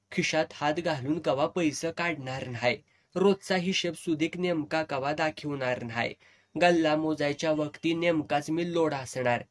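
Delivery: ordinary delivery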